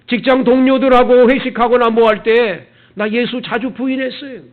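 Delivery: loud, high anguished wailing; wailing confession. Retold or imitated. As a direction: cry it out in despair. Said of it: "막 울면서 그냥 통곡하고". (통곡하듯 울부짖으며)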